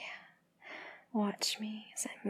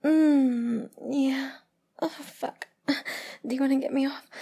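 higher, breathy gasps between phrases; aroused moaning; 5 semitones higher, 5 dB louder than usual. Acted. Lover reacting to a partner's touch, higher aroused moaning. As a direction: let pleasure push the voice up.

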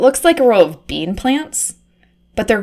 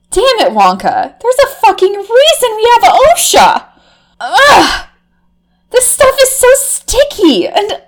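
high disgusted squeal; 9 semitones higher, 4 dB louder, slower than usual. Acted.

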